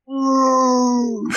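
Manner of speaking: high mock pained ooh; a small whining ooh; mock whimper. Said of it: "he goes".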